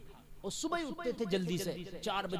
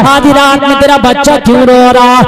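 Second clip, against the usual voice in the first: full-force preaching; 4 semitones higher, 29 dB louder than usual. Acted. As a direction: chant the tale to the crowd, stretching the key lines. Chanted story of Umar, sermon. (Josheela was, लंबी खिंची ऊँची आवाज़)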